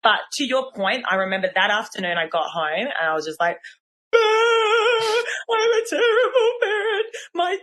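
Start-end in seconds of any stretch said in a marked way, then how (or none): 4.2-7.6 high, strained, near-tearful wail, fast at the end; half-mock self-pity as if about to cry; despairing parent. Mum at home after a bad day, mock wailing and higher.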